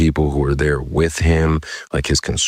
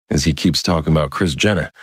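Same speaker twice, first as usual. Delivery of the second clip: plain reading voice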